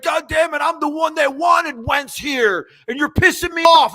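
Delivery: imitating a coach's irate outburst; angry yelling. Coach blowing up at reporters, angry yelling. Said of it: "He was yelling at the re-, reporters".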